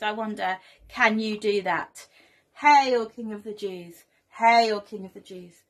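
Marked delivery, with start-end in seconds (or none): 2.5-5.6 lightly acted mocking hail, "Hail" louder; mock homage. Showing children how soldiers mocked Jesus, lightly voiced mock homage. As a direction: call out "Hail" in pretend reverence, then let it drop. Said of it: "they weren't saying it in a nice way".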